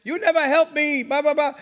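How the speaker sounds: put-on Italian accent. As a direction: play an exasperated mom with an Italian accent. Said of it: "in her great Italian accent".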